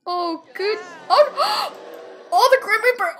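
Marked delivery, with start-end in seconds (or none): none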